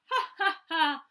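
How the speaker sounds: loud belly laugh; hearty laugh. Acted. Percy laughs out loud at the frightened animals. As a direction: laugh out loud, not scared at all. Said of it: "he laughed out loud".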